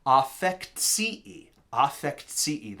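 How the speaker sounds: "affectii" drawn out slowly, with a "tsee-ee" ending; "affectii" as ah-fekt-SEE-ee, deliberately slow; careful pronunciation. Shown as a demonstration. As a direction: say "affectii" slowly, sounding "ts" then a long double ee. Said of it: "was not affecti, but affectii".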